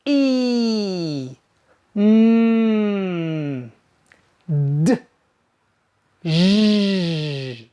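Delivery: e, mm, d, zh hummed with full voice; long sustained e and mm; voiced demo sounds. Shown as a demonstration. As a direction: let the cords buzz. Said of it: "For example".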